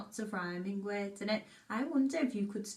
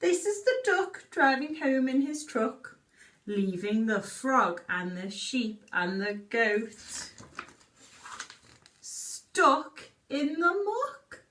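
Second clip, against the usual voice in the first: put-on reading a story to children; 4 dB louder, slower than usual. Reads picture book aloud.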